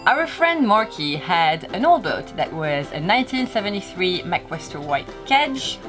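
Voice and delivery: usual speaking voice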